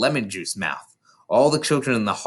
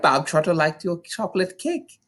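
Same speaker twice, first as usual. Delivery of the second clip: high, mocking sweetness, slowing down; Trunchbull; 6 semitones higher than usual. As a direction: fake kindness with menace underneath. Trunchbull voiced in a story.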